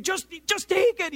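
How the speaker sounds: high-pitched, desperate parental demand; frantic parent. Retold or imitated. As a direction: imitate a worked-up parent over a screaming child. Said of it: "she's crying and she's screaming".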